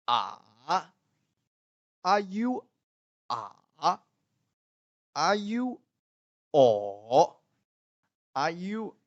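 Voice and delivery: high "Are you", then a long vowel dipping and rising; third-tone drill. Shown as a demonstration. Teacher drills third-tone feeling on vowels, dipping question tune. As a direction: swap "sure" for single vowels, each bent down then up. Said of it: "this is the feeling that you should".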